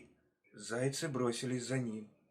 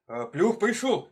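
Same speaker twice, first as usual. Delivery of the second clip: high, loud alarm; shrieked-out warning, raised pitch; hares crying out; 7 semitones higher, 8 dB louder than usual. A narrator's reading. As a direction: shout it like a panicking crowd. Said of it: "кричат хором зайцы".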